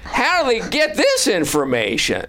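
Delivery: hack comic bit. Retold or imitated. Retelling an old stand-up bit, imitating the hack comic high. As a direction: ham up a stale penguin joke. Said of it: "he would go".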